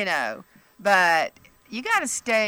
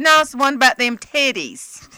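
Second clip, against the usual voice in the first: higher, louder blunt country woman; blunt country lady; 7 semitones higher, 8 dB louder than usual. Retold or imitated. Fan naming the book bluntly, higher and louder. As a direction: say it plainly and proudly.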